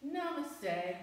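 bow politely to the camel; polite caterpillar. Acted. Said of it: "still polite and says".

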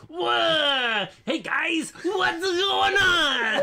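high, loud, slow squawking shout; Gilbert Gottfried impression. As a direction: screech it out like a shrill comic. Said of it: "that's my Gilbert Gottfried".

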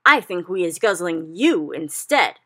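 a flesh-eating giant bellowing at the BFG. A narrator's reading. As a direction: roar it slowly like a brute.

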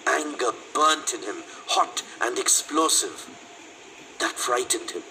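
ordinary delivery

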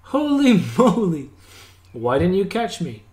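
high, drawn-out exclamation, then mild reproach; offended. A narrator's reading. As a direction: protest as if let down. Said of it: "looked at me with offense".

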